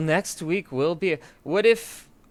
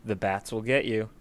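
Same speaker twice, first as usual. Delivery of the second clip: low, softly ominous sign-off; mock-ominous warning; 8 semitones lower, 4 dB quieter than usual. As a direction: lower the voice for a pretend warning. Echoes the joke's warning as sign-off.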